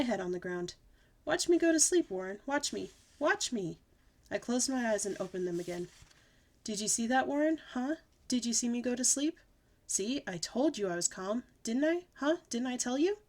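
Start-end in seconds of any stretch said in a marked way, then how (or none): 1.2-3.8 high, loud watch-me; demanding attention like a kid; hammed-up child insistence; insistent little girl. 6.6-13.2 high eager questions; fishing for praise like a kid; light child voice; pestering little girl. Acted.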